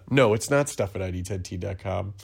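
ordinary talk

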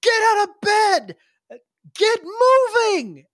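high, stretched-out wake-up yell; hollered orders. Acted. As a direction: rouse the sleepers from up in the air. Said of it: "she turns around and she goes".

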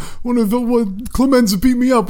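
high, whiny victim voice; a guy waiting at the doctor, high whiny voice; beaten-up guy. Pretend. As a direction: sell it as a battered man complaining. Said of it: "a guy would come in like".

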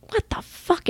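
high, breathy shocked exclamation. Retold or imitated. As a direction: gasp it out in disbelief. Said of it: "And I'm".

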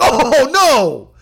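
a high, loud oh no; mock dismay. Real, not acted. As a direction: leap up groaning in glee. Leaps up at trade news.

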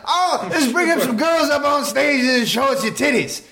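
high, hollered emcee announcement; hype man. Retold or imitated. Emcee calling people up on stage, hyped. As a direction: holler it out to a rowdy crowd. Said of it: "He's like".

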